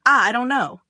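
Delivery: scream. A narrator's reading. Cornered guy screams at the girl. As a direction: blurt it out in a loud scream. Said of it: "He screams".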